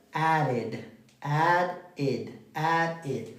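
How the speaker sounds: "added" as two clear syllables, ad-id; -id ending. Shown as a demonstration. Teacher drills the pronunciation for students, "added" ending in "id". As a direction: repeat "added" slowly apart, ending in "id". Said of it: "¿Cómo lo vamos a pronunciar?".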